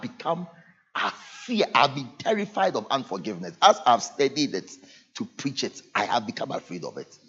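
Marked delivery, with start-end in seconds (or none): none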